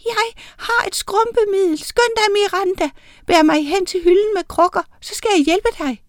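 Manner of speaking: meget lys, lille heksestemme; råbende lillebitte heks. A narrator's reading. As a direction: pib ivrigt som en bitte heks. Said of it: "En af dem råbte". (høj, pibende råben fra en miniheks)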